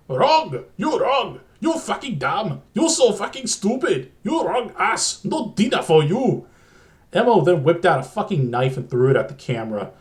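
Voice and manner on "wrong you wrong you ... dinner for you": shrill-free, higher yelled lines in a hammed-up Chinese accent; mock Chinese accent. Raging puppet berates the player, hammed-up Chinese accent yell. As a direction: bark the insults in an exaggerated Chinese accent, pitched up. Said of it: "screamed in an incredibly insensitive Chinese accent".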